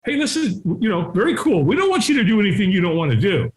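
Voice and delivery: high, soothing no-pressure patter; imitated easygoing reassurance. Prepared helper to reluctant man, imitated reassurance.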